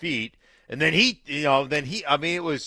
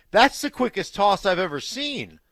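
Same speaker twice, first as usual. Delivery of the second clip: disbelief; 7 semitones higher, 5 dB louder than usual. Retold or imitated. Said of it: "I was like".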